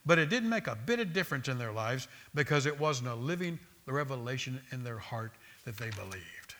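ordinary delivery

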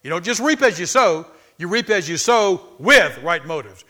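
loud, slow preacher's cadence; preaching. Acted. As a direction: drive the point home to the congregation. Preaching at full force.

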